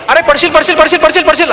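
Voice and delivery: high, urgent chant of warning; crowd calling out. Acted. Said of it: "असं एक मधले पन्नास लोक म्हणतात ना".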